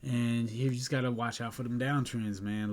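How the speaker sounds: normal talking voice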